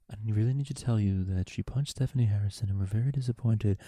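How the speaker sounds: hushed, low public-radio host; NPR voice. Acted. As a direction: say it softly and low, like a measured radio presenter. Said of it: "use my N P R voice".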